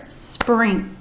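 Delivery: "Sprink" clipped off on its own; first syllable only. Shown as a demonstration. Teacher isolating "Sprink" for spelling. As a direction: say just "Sprink" as the first chunk. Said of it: "Let's do the first syllable".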